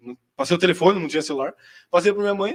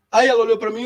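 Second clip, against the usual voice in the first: normal talking voice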